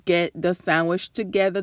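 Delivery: ordinary talk, nothing marked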